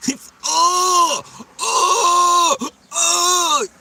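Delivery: high, loud mock cries of pain; pained groans. [name] after hitting the ice, high loud pained cries. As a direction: howl like you just cracked your head. Buddy hurt hitting icy snow.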